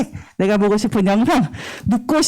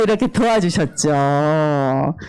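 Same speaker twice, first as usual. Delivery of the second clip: ordinary delivery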